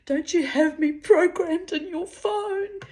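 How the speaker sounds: high, loud mocking man's voice; imitating a cheeky bloke fishing for attention; cheeky caller. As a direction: send him up as a cheeky suitor. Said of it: "he got cheeky".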